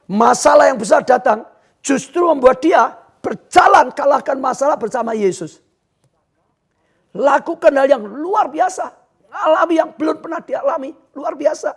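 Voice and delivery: high, fervent sermon delivery; full-force preaching. Real, not acted. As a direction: preach it with rising fervour. (khotbah berapi-api)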